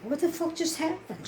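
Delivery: high-pitched, hurt incredulity; retold baffled reaction; bewildered disbelief. Bewildered after she walks off.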